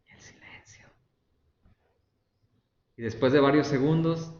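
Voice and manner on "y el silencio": barely audible, voiceless aside; hushed whisper. Acted. Preacher marks the silence after God's answer, whispered.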